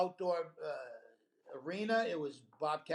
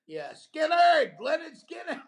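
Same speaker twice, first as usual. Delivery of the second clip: yelling hecklers; 10 semitones higher, 10 dB louder than usual. Retold or imitated. Comic retells hecklers yelling at Bobcat, loud high holler. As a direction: holler it like a rowdy southern crowd. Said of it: "these guys start yelling at him".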